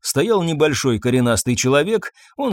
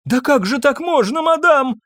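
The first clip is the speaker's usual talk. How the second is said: high, distressed wail; tearful maid's protest; breaking into tears; 12 semitones higher than usual. A narrator's reading. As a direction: plead tearfully, pitch climbing. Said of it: "Она разрыдалась".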